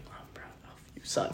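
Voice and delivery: whispered words of praise; retelling dad's quiet praise in a whisper. Shown as a demonstration. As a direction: breathe it out, barely voiced.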